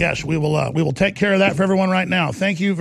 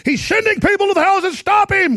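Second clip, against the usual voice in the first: much higher, louder, a panicky alarmist voice; mocking the Post; 12 semitones higher, 4 dB louder than usual. Host mocking the Washington Post's claims, shrill panicked parody. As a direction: parody the media's hysterical alarm. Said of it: "the Washington Post says".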